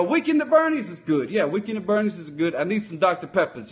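normal talking voice

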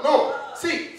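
normal talking voice

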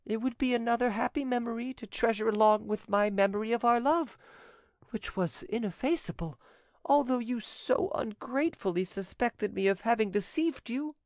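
high heroine voice, overcome with gratitude; broken, grateful voice. A narrator's reading. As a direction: pour out thanks in a sustained high pitch. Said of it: "she said in a broken voice".

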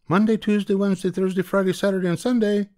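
calling out. Acted. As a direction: call the children from the door. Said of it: "she called".

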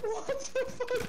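high, slow disbelief; shocked. Real, not acted. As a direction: drag it out stunned. Sudden shock.

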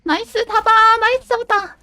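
high, loud cheer; excited shout. Real, not acted. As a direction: yell it out in triumph. Catching a survivor in game.

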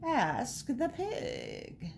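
soft, very slow cat voice; purring cat. A narrator's reading. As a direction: stretch each word out softly like a cat. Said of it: "purred the cat".

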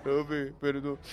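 low, quiet mumbled sorry; meek apology. Retold or imitated. Student begging the teacher's pardon, meek and quiet.

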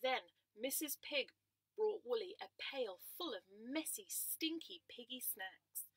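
gentle storytime narration; reading to children. A narrator's reading.

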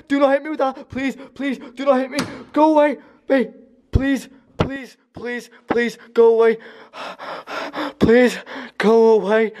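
high, panicky pleading; desperate pleas in short bursts; frightened begging. Acted. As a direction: beg the attacker in a high, scared voice. Begging not to be hit.

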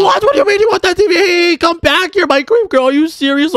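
outraged shout. Acted. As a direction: yell after the thief to come back.

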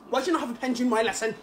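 rapid-fire teacher scolding; fast scolding teacher. Retold or imitated. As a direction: rattle it off like a strict teacher. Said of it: "That's my favorite one".

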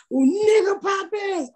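high, drawn-out audience holler; imitated yell. Fans heckling with lines from the TV show, imitated yell. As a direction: mimic a fan yelling out a show line. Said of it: "They've started to yell out".

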